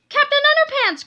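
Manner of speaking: put-on loud panicked kid's cry; alarmed cry. A narrator's reading. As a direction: shout down to him in panic. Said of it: "cried George".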